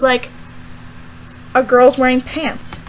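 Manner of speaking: ordinary talk, nothing marked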